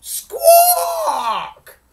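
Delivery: very high, piercing squawk; loud hen squawk. A narrator's reading. Storyteller imitating the hen in the hall, squawking. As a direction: screech it out like a startled hen. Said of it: "he heard a".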